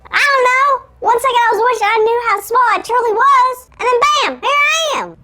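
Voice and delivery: high, excitable cartoon elf voice. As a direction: tell it in a tiny squeaky panic. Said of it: "sound like a cartoon elf".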